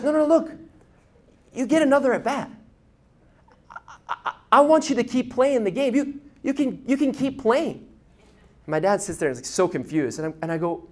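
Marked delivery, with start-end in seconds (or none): 0.0-7.9 encouraging coach. Retold or imitated. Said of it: "And he goes".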